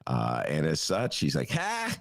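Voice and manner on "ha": sharp high mock laugh; hammed-up high politician scoff; Governor Pritzker impression. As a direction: bark a high one-syllable "Ha!". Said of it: "That was my Governor Pritzker imitation".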